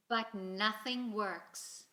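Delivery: ordinary delivery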